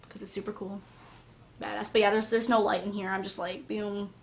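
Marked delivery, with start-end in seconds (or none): none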